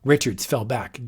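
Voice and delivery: plain reading voice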